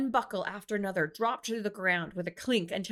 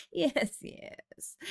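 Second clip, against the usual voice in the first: higher, breezy brush-off; dismissive; 7 semitones higher than usual. A narrator's reading. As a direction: wave it away with a light voice. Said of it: "Hoya dismissed the returned insult".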